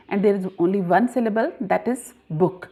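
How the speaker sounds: normal talking voice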